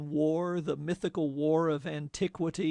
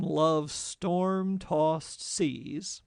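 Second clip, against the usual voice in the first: slow savoring; much slower than usual. Shown as a demonstration. Poetic phrase savoured while explaining.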